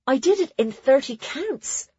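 put-on high, pleased girl's voice; proud announcement. A narrator's reading. Nona telling friends her record time, proud. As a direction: announce it proudly, lifting at the end.

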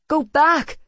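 high, loud cry of command; urgent cry. A narrator's reading. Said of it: "she cried".

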